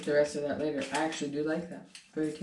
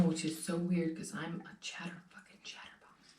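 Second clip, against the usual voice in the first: hushed aside while chewing; whispering; 10 dB quieter than usual. Real, not acted. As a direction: whisper it under the breath.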